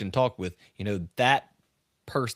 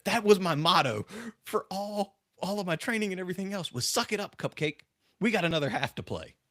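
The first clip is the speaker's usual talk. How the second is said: put-on proud of the old motto; former player; 8 semitones higher than usual. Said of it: "And he said".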